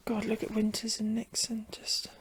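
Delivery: whispering. Real, not acted. Quiet aside while watching.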